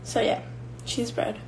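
eating while talking; mouth full. Real, not acted. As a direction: say it through a mouthful of food. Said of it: "'cause I'm, like, eating at the same time".

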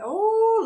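high, drawn-out cry of pain; retold pained "oh". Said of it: "'cause I hurt so bad".

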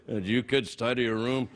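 low, stern dad voice; nagging dad. Acted. Host playing The Walking Dad, nagging dad voice. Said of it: "a show called The Walking Dad".

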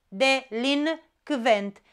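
delinquent said slowly, split into syllables. Shown as a demonstration. Teacher showing the split, slow delinquent.

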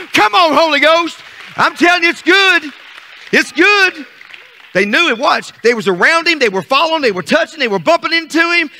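high, driving revival preaching; impassioned pulpit cadence, rising in pitch; full-force preaching. Real, not acted. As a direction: build the congregation up to a shout.

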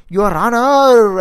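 loud, high, drawn-out courtroom cry; old-film courtroom shout. Retold or imitated. Mocking the lawyer master's old-cinema courtroom act, shouted loud and slow. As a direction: mimic a film hero bellowing to the judge, stretched long. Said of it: "గట్టిగా అరిచి".

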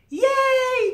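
very high, loud squeal of joy; cheering. Acted. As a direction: cheer it like a crowd of little squirrels. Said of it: "cheer the squirrels".

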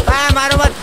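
high yelp under attack; panicked plea. Pretend. Streamer begs players not to hit him, playful panic. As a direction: cry out for mercy in a game, pitched right up.

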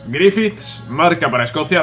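normal talking voice

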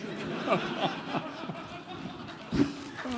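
breathy giggling laugh; real laugh. Real, not acted. Guest laughing after the back passage joke. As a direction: laugh at your own joke.

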